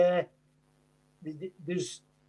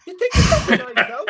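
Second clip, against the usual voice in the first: laughing; 8 semitones higher, 8 dB louder than usual. Real, not acted. Laughing teasing.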